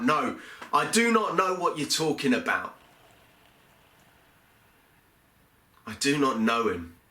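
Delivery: panicked protest, pushed up then backing off; fearful denial. Acted. Peter denying Jesus to the serving woman, frightened cry. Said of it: "In fear, I cried out".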